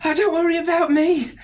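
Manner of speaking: high, loud, flustered mother voice; fussing mother. Acted. Mother asking after her child's friends, fussing. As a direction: brush off concern and fuss over your child. Mother deflects concern, fusses.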